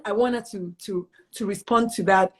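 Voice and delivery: ordinary talk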